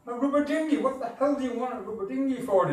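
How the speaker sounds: high, fast American disbelief; American twang. Retold or imitated. American airman mocking the boy's request, American twang. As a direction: scoff at the kid in a Yankee twang. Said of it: "in his American twang".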